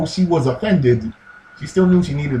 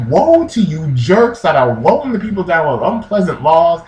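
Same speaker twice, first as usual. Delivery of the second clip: raised-pitch mock tirade; hammed-up scolding rant, higher voice; smack talk; 4 semitones higher, 4 dB louder than usual. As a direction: lay into them with mock fury. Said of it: "starts talking this smack like".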